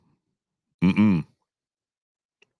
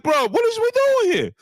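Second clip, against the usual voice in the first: high squeaky protest; 12 semitones higher, 3 dB louder than usual. Retold or imitated. Tank pleading to be let go, high whine. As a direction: pitch way up, whining as Tank. Voicing Tank, much higher.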